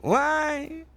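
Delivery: high, drawn-out tearful wail; mock crying. Beaten heart asks the asshole, mock crying. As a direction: whimper it high and slow, hammed-up. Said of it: "the heart's crying like".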